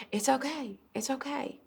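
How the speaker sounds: soft, slightly high hush; soothing. Acted. Daughter with hands on father's shoulders, soothing softly. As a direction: comfort him very gently.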